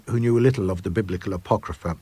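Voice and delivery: usual speaking voice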